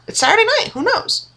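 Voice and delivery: excited. Acted. Playful teasing about going out.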